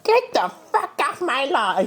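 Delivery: high crotchety granny voice. Pretend. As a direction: squawk it like a cranky old granny. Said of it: "give the people your best granny voice".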